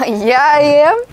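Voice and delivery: high, loud eager yes; excited. Real, not acted. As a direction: gush with fan excitement. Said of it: "You seem very passionate about the subject".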